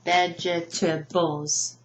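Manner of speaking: "vegetables" slowly as vej-eh-tuh-bulls; "vegetables" in four slow beats, learner's mistake; mispronounced with extra syllable. Shown as a demonstration. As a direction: break "vegetables" into four chunks, voicing the silent E. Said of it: "I often hear".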